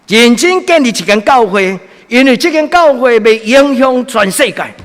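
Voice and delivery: high, loud impassioned sermon; fervent preaching. Acted. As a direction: preach it with full fire. Preaching at full force.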